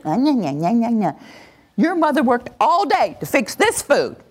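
a hint of a nagging parent, taunting nya nya; mock the child's back talk, then scold. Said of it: "started running your mouth".

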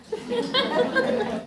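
high airy laugh at the bad example; a quick amused laugh. Real, not acted.

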